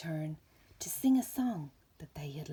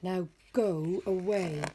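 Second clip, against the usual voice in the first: gruff bear dismissal; 6 dB louder than usual. Acted. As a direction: send the bird off with a growl. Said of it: "Bear growled".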